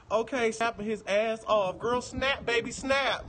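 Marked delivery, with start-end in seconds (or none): none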